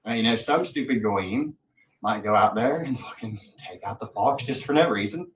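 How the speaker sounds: normal talking voice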